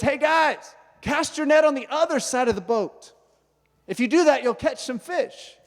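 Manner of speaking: calling out high across the water; yelling from shore. Retold or imitated. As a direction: shout it to a boat far out. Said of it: "starts yelling at them".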